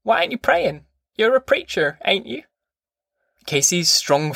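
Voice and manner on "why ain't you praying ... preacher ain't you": high-pitched granny challenging the preacher; grandmother's pointed, pitched-up questioning; insistent grandma. A narrator's reading. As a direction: press him in a high, put-on old-woman voice. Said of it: "she said quickly".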